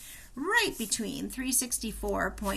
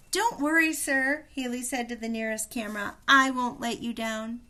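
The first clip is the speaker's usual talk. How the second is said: eager call to camera; 3 semitones higher, 4 dB louder than usual. Acted. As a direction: call it up to the camera with a bright promise. Haley calls to camera.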